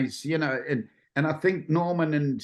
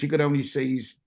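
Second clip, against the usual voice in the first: ordinary delivery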